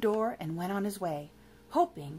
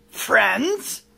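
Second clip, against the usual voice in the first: loud, disbelieving question; incredulous cry; 11 dB louder than usual. Acted. Villain echoing the monster, incredulous. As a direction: bark it back in disbelief. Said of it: "cried Uriah the Heap".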